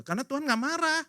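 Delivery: usual speaking voice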